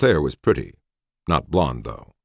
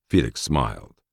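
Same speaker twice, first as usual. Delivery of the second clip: usual speaking voice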